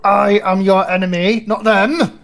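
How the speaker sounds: shouted paladin battle cry; righteous paladin declaration. Acted. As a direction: bellow it as a defiant holy knight. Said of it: "I'm just gonna shout as I'm going for it".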